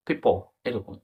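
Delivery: normal talking voice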